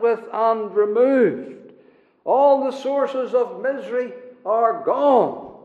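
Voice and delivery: high, ringing sermon declaration; emphatic preaching. Real, not acted. Preacher assures congregation the old sorrows are gone, emphatic preaching.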